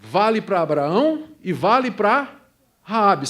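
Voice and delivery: ordinary delivery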